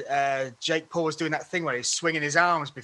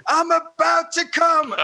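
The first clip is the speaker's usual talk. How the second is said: higher, louder, slower, laughing through the imitated yell; laughing mock yell; 12 semitones higher, 5 dB louder, slower than usual. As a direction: yell it again while cracking up. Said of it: "Three times he shouted this".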